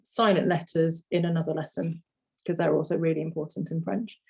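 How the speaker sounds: ordinary talk, nothing marked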